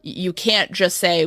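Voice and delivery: normal talking voice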